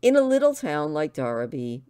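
ordinary delivery, nothing marked

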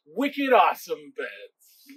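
put-on slow, drawn-out Boston accent. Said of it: "Can you do a Boston accent with me?".

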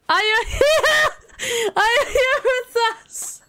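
high call cracking into laughter; laughing call. Real, not acted. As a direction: call out to the missing winner, giggling. Calling an absent winner, breaking into laughter.

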